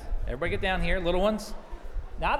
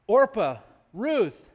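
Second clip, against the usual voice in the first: louder storybook call; crying out; 3 semitones higher, 6 dB louder, much slower than usual. A narrator's reading. Naomi calling her daughters-in-law, raised, lightly acted cry. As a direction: call out the names, one by one. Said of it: "Naomi cried".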